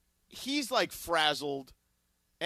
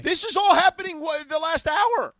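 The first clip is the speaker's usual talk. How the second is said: much higher and louder, real disbelief; exasperated outburst; 12 semitones higher, 11 dB louder than usual. Real, not acted. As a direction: nearly shout it in frustration, slowing at the end. Outburst at the ridiculous timing.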